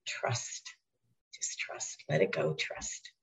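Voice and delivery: hushed whisper, soft and unhurried; inner voice whisper. Retold or imitated. Inner voice urging her to trust, whispered. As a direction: breathe it like a guiding inner voice. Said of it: "And then the voice said".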